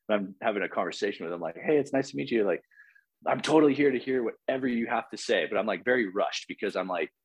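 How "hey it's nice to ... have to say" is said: high, over-eager welcome; eager polite greeting. Retold or imitated. Telling the spirit being he is here, high and eager. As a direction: sound keen and rushed to please.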